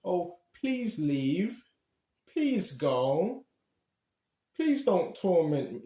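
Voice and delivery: slow, meek begging of a timid exorciser; timid pleading. Pretend. Mocking a timid believer begging spirits to leave, slow meek plea. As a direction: plead weakly, drawn out, no authority. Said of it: "You don't wanna be timid".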